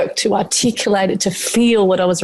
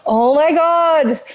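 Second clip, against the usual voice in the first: high gasp then slow, stunned oh my God; sudden dawning shock; gasp of realization; 8 semitones higher, much slower than usual. Retold or imitated. Retelling the moment she realized, stunned gasp. Realizing cause during session.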